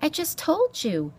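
high, pleading mouse voice. A narrator's reading. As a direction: plead as a small frightened mouse. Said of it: "Poppy pleaded".

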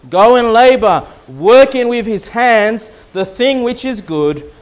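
scripture reading. Acted. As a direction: read it like Scripture from the pulpit. Reads scripture aloud.

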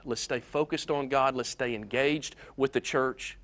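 normal talking voice

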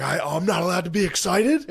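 high, loud indignant mock protest; imitated outraged, excited shout; Philip Rivers yelling. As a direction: yell it like an overexcited kid objecting. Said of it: "He starts yelling that to the D N".

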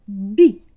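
short, loud, isolated "бі"; окремий перший звук. Shown as a demonstration. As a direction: pop out "бі" as one brief sound. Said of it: "який звук перший в слові?". (коротке, гучне, окреме "бі")

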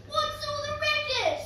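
high-pitched cry; cried out. A narrator's reading. Cindy poking her head round the door, crying out. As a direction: call it out high. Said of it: "Cindy cried".

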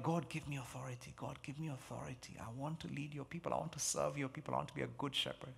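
hushed, soft pleading prayer; quiet prayer. Retold or imitated. Young pastor quietly praying to God before preaching, hushed. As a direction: murmur it like a scared young leader praying. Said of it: "I'd be like".

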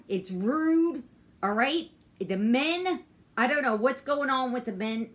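high, loud indignant complaint; scolding the disrespectful men, loud; real angry venting. Real, not acted. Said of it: "Do you hear my frustration?".

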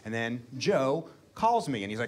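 usual speaking voice

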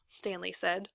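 ordinary delivery

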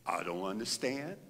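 a low, soft voice outside his own; soft mysterious voice of God; low hushed voice of God. Retold or imitated. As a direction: hush it, a voice from nowhere. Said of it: "a voice outside of my voice".

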